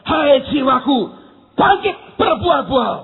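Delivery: loud, punched rallying cry; rousing command. Retold or imitated. Troubled preacher orders his soul to rise, rousing command.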